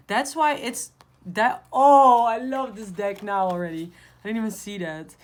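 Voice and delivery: loud, high delighted exclaim; excited. Real, not acted. Thrilled by the dark star card, loud excited exclaim. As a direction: gush over the card.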